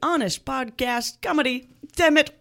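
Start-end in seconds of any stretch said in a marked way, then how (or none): 2.0-2.3 frustrated outburst. Real, not acted. Host flubs, curses loudly.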